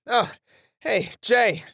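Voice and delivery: loud, high calls to wake a knocked-out partner; yelling to wake him. Acted. Partner yelling to wake a knocked-out Jay, loud and urgent. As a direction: shout him awake, urgent. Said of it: "stop yelling in my".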